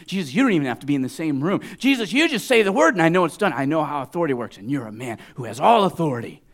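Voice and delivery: fast, loud, rising plea; urgent, high-pitched declaration of trust; fervent faith. Acted. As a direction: rush it out with full conviction. Preacher voices the centurion's faith.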